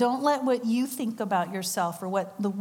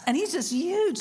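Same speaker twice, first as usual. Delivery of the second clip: huge pitched way up and stretched; marvelling at his size; emphasis on huge; 8 semitones higher than usual. Real, not acted. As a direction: lean hard on the word huge. Stressing how big he was.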